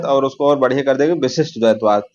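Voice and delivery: ordinary talk, nothing marked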